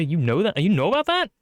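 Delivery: usual speaking voice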